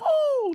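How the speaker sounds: high drawn-out owl hoot; owl call. Retold or imitated. Recalling the owl heard at night, high hoot. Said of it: "all I've heard is".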